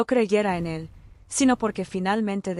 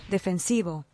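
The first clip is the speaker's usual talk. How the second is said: ordinary delivery, nothing marked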